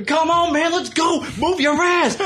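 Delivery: very high, cartoonish wrestler voice; hammed-up high wrestler voice, hurrying a friend out of a diner; Honky Tonk Man impression. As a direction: squeal it out like a cocky old wrestler waving a buddy on. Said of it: "there's fucking Honky Tonk Man".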